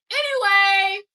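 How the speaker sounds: high loud shouted anyway. Real, not acted. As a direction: shout it to move on.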